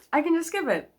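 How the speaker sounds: happy little announcement; gleeful. Real, not acted. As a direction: sing it out, pleased with yourself. Delighted at skipping scenes.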